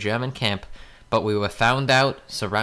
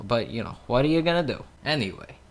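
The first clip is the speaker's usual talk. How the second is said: ordinary talk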